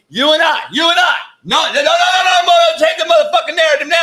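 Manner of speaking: high, excited shouting; hyped shout. Real, not acted. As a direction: get fired up and shout it out. Hyped rant about taking over.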